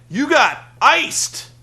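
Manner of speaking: high, loud, slow "You got iced!"; gleeful taunt. Retold or imitated. Beer Money taunting Ric Flair, slow loud gleeful gloat. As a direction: gloat it out like the prank landed. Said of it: "And Beer Money's like".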